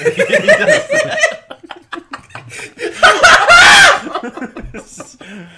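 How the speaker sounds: long high shrieking laugh; hysterical laugh. Real, not acted. Friends howl at a pointless anecdote, shrieking.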